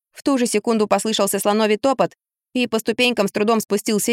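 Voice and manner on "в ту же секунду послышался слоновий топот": ordinary delivery, nothing marked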